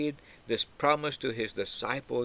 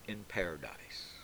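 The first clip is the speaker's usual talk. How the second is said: whispered close to the ear; hushed whisper; 6 semitones lower, 13 dB quieter than usual. Acted. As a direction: drop to a breathy whisper on paradise. Jesus's promise, hushed close.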